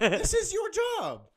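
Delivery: high, loud, slow indignant plea; hammed-up outrage; exasperated protest. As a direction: plead with the nurse in disbelief. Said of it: "And I'm like".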